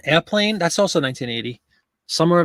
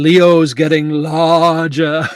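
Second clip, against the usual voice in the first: slow movie quote; 7 dB louder, much slower than usual. Retold or imitated. Airplane quote, slow drawn-out.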